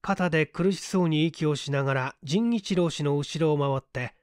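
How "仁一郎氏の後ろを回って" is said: usual speaking voice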